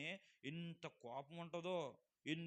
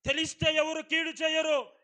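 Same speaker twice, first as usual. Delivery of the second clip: full force preaching; 8 semitones higher, 16 dB louder than usual. Acted. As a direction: thunder the verse at the congregation. (గట్టిగా నెమ్మదిగా ప్రసంగం)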